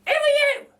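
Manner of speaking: a cheeky cockney burglar lady; Burglar Betty. A narrator's reading. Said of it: "says the lady".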